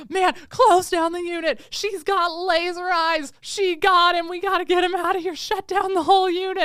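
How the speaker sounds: high, loud, hammed-up emergency shouting; mock frantic alarm. Fleeing men ordering guards to close the unit, mock panic. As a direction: raise a fake alarm to get them out.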